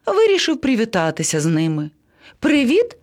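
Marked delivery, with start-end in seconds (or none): 2.4-3.0 high, bright hail to friends; eager high-pitched greeting with rising end; calling out. A narrator's reading. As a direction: call over to them, pitched way up. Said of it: "гукнув до них".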